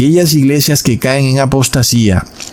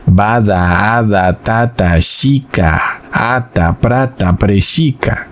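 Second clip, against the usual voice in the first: mock babbling tongues; 3 semitones lower, slower than usual. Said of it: "cuando dicen".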